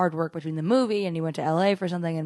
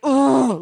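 loud, higher mock-angry "oh"; angry groan; 6 semitones higher, 8 dB louder than usual. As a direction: imitate his exasperated outburst. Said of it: "like, really angry".

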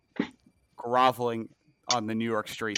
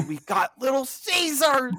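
very high gushing cheer; mock excited; 13 semitones higher than usual. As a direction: cheer it like a big win. Mock celebration.

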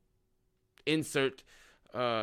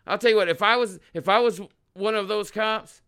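normal talking voice

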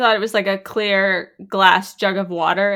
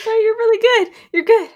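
very high, louder sweet reassurance; mock reassuring; 14 semitones higher, 5 dB louder, faster than usual. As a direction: coo it to comfort her. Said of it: "She's like".

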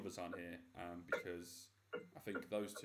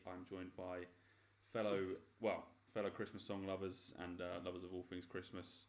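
ordinary talk

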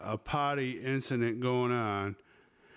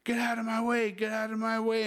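retold yell, pitched much higher; 11 semitones higher than usual. Panicked man yelling at his wife to move, high retold yell. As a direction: shove the words at her, high and urgent. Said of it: "And I start yelling at her".

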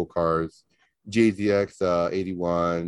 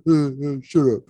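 mock sputtering, pitched up; higher, hammed-up sputter of protest; flustered comeback; 7 semitones higher, 4 dB louder than usual. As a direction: imitate a sore loser stammering back. Jealous friend's flustered comeback, retold.

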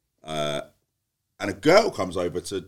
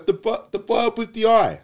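higher, slow, spelled-out mock insistence; exasperated insistence; 13 semitones higher, 6 dB louder, slower than usual. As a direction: drag it out like he's being thick. Insisting to Dave outside.

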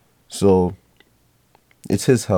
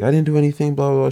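high-pitched protest, only slightly louder; mock screaming; 8 semitones higher, 3 dB louder, much faster than usual. Girlfriend denying it to grandpa, mock screaming. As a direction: imitate her screamed denial, pitched up. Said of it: "screaming, screaming".

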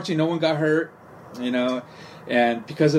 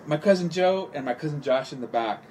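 usual speaking voice

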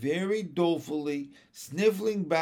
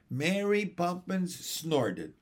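ordinary delivery, nothing marked

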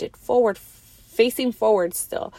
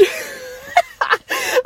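shrieking laugh, much higher. Real, not acted. Recalling her fall to viewers, a high shrieking laugh. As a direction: burst into a squealing laugh.

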